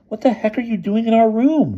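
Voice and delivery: high, loud demanding voice. A narrator's reading. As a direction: demand an answer. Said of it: "demanded Boots".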